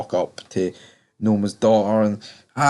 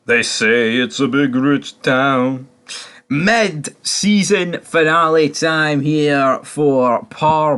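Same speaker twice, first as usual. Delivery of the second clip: louder, higher, slow hyped host voice; YouTube intro announcer; 3 semitones higher, 6 dB louder, slower than usual. Acted. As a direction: announce it like the channel opener, drawn out. Loud slow channel intro.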